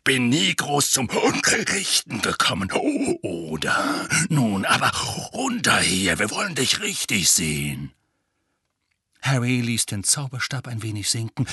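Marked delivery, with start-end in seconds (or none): 0.0-7.9 growling voice. Acted. Moody keeps talking.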